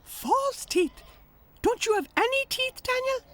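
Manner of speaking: can't believe it; astonished little tractor. A narrator's reading. Tech asks Daniel the duck, high astonished voice.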